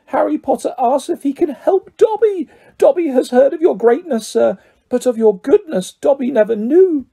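very high, adoring house elf; Dobby, overwhelmed. A narrator's reading. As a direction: marvel gratefully in a high creature voice.

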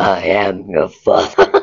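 very deep demonic growl. Acted. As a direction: drop into a monstrous low voice. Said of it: "doing a demon voice will actually get you".

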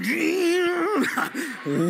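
put-on drawn-out call, like a boy on the shore; calling out to Jesus. A boy calls out to Jesus walking on the water. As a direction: call his name across the water. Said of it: "I said".

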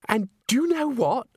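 put-on high, girlish gush; gushing exclamation. A narrator's reading. Christine shows Agnes her new dress, exclaiming. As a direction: show off in an excitable high voice.

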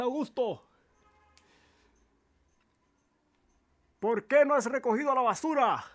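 high-pitched distant shouting; calling from afar. Acted. Farm housemates bickering over rubbish, shouted from afar. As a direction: holler it across a field, high and loud. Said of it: "una voz al fondo".

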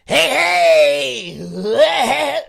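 high, loud raspy clown yell; sudden shrieking clown greeting; Krusty the Clown. Pretend. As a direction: blast it out like a manic clown.

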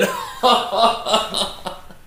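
high cackling laugh. Real, not acted. Joker laughing at his own burn, high cackle. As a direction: burst into high laughter at your own jab.

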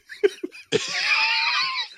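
high squealing laugh; shrieking laugh. Real, not acted. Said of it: "sound effect being added".

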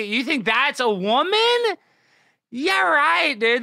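loud, high mocking disbelief; sarcastic scoff. Streamer scoffs at the viewers, loud and sarcastic. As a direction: scoff hard at the claim.

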